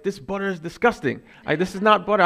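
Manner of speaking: high-pitched indignant complaint; mock disgusted outburst. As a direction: act out storming off in disgust. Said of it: "he storms out".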